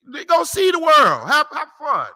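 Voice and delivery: high, loud and drawn out; mocking send-off. Real, not acted. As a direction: holler it like a taunting farewell. Mockingly sends outsiders off travelling.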